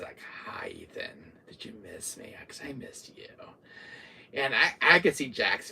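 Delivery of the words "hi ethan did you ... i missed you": hushed, creepy villain whisper; very soft mock-sweet menace; taunting. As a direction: whisper it like a stalker toying with prey. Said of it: "he's just taunting me".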